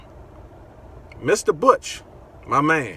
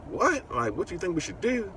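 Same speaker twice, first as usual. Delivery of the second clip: stunned disbelief; 8 semitones higher than usual. Retold or imitated. Asked whether to cut him.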